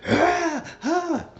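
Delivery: imitating the wrecking rage; mock rage grunts. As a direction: yell out like a man smashing things. Imitating Bennett's rage noises.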